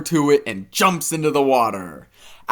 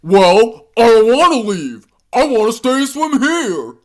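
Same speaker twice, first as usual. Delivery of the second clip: higher, loud stubborn voice; defiant alligator; 9 semitones higher, 5 dB louder than usual. Acted. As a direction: dig in your heels and refuse. Alligator refuses to leave.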